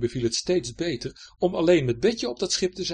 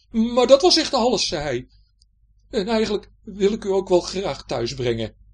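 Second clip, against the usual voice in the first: high, loud stammering surrender; meek pirate Hendrik; 6 semitones higher, 9 dB louder than usual. Acted. Hendrik giving Betje his weapons, meek. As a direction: hand over the weapons humbly.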